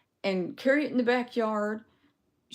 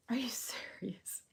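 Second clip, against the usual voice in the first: whispered; 2 semitones higher, 12 dB quieter than usual. Retold or imitated. Disbelief on realizing she lied.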